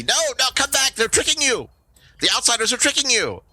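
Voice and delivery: high-pitched frantic goblin warning; alarmed goblin. Acted. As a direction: call your friend back in a squeaky goblin voice.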